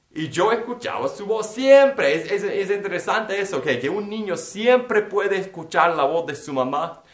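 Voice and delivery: ordinary talk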